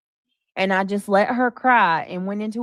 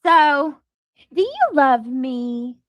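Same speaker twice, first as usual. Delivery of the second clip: put-on fish for love from the kids; mock doting mom; 3 semitones higher than usual. Mocking a streamer mom, higher needy voice. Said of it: "we gotta do the baby voice".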